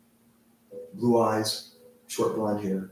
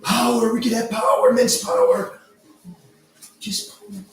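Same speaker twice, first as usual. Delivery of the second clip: high, loud crazed whisper of power ending in a shout; power-hungry dwarf; 13 semitones higher, 7 dB louder, slower than usual. Acted. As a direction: lust for power, then panic. Dwarves fight over pouch.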